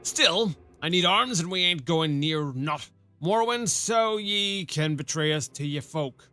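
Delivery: put-on a rough rural-British-ish sergeant accent, loosely done; half-baked rustic soldier accent; gruff old soldier accent. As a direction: play the one-armed sergeant with a put-on country burr. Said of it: "My accent is terrible".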